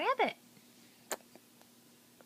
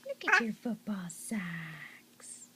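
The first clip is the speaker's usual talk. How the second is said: whispering; 8 semitones lower, slower than usual. Real, not acted. Cooing at baby.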